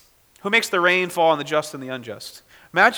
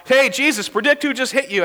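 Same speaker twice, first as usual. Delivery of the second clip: a mild mock taunt, pitched higher; mocking jeer; 8 semitones higher than usual. Preacher voicing the soldiers taunting blindfolded Jesus, mild mock jeer. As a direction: jeer at him like the mocking crowd. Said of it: "crying out".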